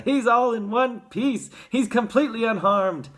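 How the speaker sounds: high, loud rejoicing; triumphant, excited announcement; jubilant cry. A narrator's reading. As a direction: proclaim it in delight. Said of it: "cried Mister Wonka".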